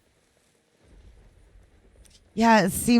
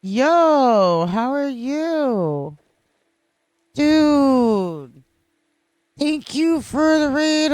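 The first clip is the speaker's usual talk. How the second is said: loud, higher, gushing welcome; excited greeting; 6 semitones higher, 8 dB louder, slower than usual. Real, not acted. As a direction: greet your friend with big delight. Greeting a raider loudly.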